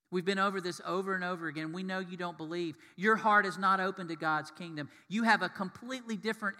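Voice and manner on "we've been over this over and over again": ordinary talk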